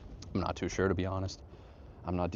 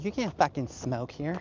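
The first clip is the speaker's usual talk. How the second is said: lightly voiced scolding Karen, pitched up; a higher-pitched imitation of a Karen; mock Karen; 7 semitones higher than usual. Karen on the trail objecting to smoking, mock scolding. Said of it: "some Karens and moms".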